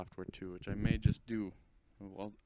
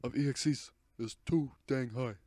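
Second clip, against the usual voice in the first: loud, high, indignant ranting declaration; meme ranter voice; 4 semitones higher, 8 dB louder than usual. Retold or imitated. The vlogger declaring there are too many EXE files, loud indignant rant. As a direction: proclaim it like an outraged street candidate. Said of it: "I say".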